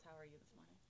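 soft, whispered repeat; relaying the question in a whisper; whispered echo. Retold or imitated. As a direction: mouth it back quietly. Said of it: "She says".

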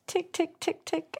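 high, soft imitated ticking; ticking clock. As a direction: tick lightly and evenly like a clock. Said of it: "your spirit is just randomly going".

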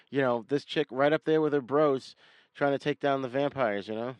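normal talking voice

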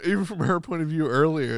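ordinary talk

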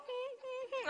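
high, soft dog whimper; imitated dog whine. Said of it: "And that dog go".

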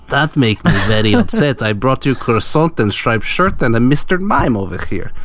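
mock French accent, halting. Whale caller upset over his gifts, mock French accent. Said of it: "everything I know about the French people".

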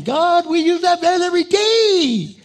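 high, loud complaining cry to God; whining to God. Retold or imitated. Pastor whining to God over the broken van, high and pleading.

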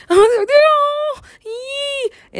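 very high drawn-out wail; mock distressed viewers. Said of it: "And they're like".

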